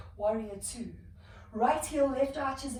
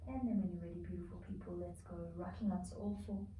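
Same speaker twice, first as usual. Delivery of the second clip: calming cue that fades to a near hush; guided relaxation; 4 dB quieter than usual. Acted. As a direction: ease the class into rest, softer and softer. Relaxation in child's pose.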